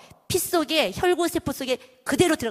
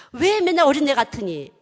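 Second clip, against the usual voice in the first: loud, raised mock telling-off; scolding reproach; 4 semitones higher, 7 dB louder than usual. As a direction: chide a childish adult, play-acted. Voicing a reproach of immaturity.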